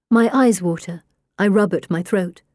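ordinary delivery

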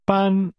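班 held on a high, level pitch. Shown as a demonstration. Teacher models one syllable for learners, high-level tone on 班. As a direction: keep 班 steady and high, without bending it. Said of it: "two high-level tones".

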